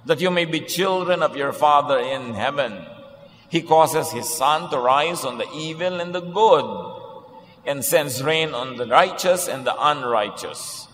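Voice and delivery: scripture reading. Acted. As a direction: read the verses out deliberately, like a lectern reading. Preacher reads Bible passage aloud.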